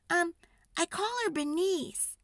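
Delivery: put-on high, innocent girl; a child introducing her squash; little girl voice. A narrator's reading. Girl telling mother her squash's name, high and innocent. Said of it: "Sophie said".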